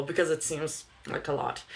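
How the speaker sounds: ordinary talk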